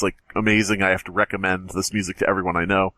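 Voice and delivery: normal talking voice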